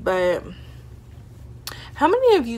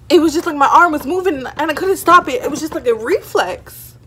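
excited; 7 semitones higher, 8 dB louder than usual. Real, not acted. Excited self-defence.

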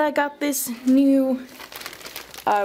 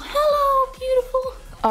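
much higher sweet hello; cooing greeting; 13 semitones higher than usual. Real, not acted. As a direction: coo it like to a pet. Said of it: "I'm just talking to my computer".

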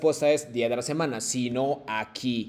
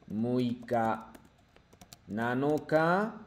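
slow counting; much slower than usual. Shown as a demonstration. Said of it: "deja escribo".

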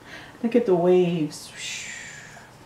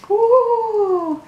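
ooh of wonder; 13 semitones higher than usual. Real, not acted. Marvelling at picture.

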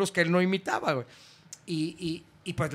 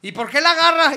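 shocked camp question; 10 semitones higher, 11 dB louder than usual. Retold or imitated. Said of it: "drag performer asks".

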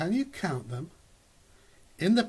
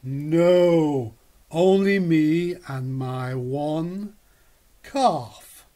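lightly voiced cow; 7 dB louder, much slower than usual. The cow answers Goz.

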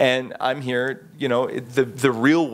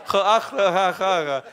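high, hammed-up "ha, ah, ha"; mock Dutch laugh; 6 semitones higher than usual. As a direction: ham up the local's laugh. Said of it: "hey, I do my best".

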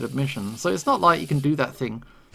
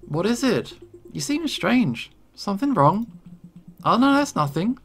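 a touch higher, curious barista voice; lightly voiced game character; 7 semitones higher than usual. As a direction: read her lines a little higher, lightly. Voicing game characters' dialogue.

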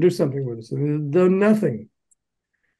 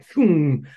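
drawn-out "phoom" of speeding off; imitated whoosh; 4 dB louder than usual. Promoter eager to reach the star's house, imitated whoosh. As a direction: whoosh it like a getaway.

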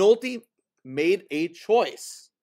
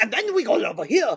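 mimicked voice climbing higher; higher-pitched imitation; 3 semitones higher than usual. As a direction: let the imitated voice rise in pitch. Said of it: "in a higher pitch".

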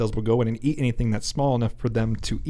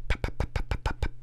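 soft, voiceless lip pops; imitated popping; 5 dB quieter than usual. Co-host imitates the tattoo laser's sound, soft popping. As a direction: make a run of quiet little popping noises. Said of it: "that's what that pop is".

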